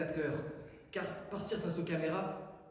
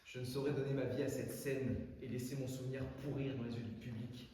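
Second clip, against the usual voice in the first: ordinary talk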